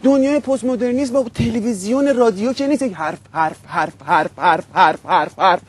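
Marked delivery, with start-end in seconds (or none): 2.8-5.6 low, repetitive mock-talking drone; low monotonous parody of chatter; droning mockery. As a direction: chant the word low, over and over. Parodies endless radio-style talking.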